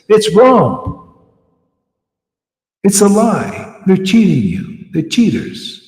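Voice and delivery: loud, high, fervent sermon; full-force preacher calling out; fiery preaching. Real, not acted. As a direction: thunder it at the listeners.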